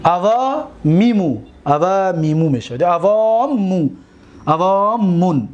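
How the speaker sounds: unhurried, drawn-out forms; slow recitation. Shown as a demonstration. Teacher to students, slowly reciting the forms. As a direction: let each form sink in slowly.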